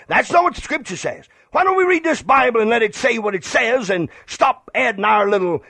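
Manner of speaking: ordinary talk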